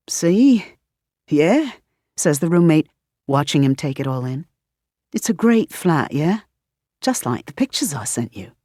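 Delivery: put-on chatty questions in a light British accent; a hint of a puzzled Brit; curious British roommate quizzing; lightly British roommate.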